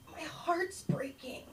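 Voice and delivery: high, slow whispered lament; whispery mock sob; sobbing child. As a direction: imitate a heartbroken kid, barely voiced.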